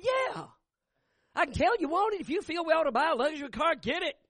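high, eager wife's voice pushing him; urging wife voice. Retold or imitated. Wife coaxing her husband to buy the car, high and eager.

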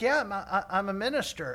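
retold shouted answer; calling back. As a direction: call back an admission, stumbling a little. Stammered shouted reply.

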